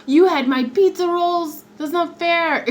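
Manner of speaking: high, loud mock indignation; loud playful complaint about eaten food; mock outrage. Real, not acted. Mock outrage over pizza rolls.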